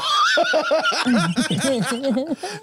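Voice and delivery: a big shouting laugh. Real, not acted. Laughing after shouted joke.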